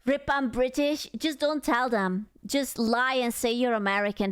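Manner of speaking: normal talking voice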